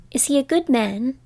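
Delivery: a touch of worry in the question; lightly anxious little girl. A narrator's reading. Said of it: "inquired the girl anxiously".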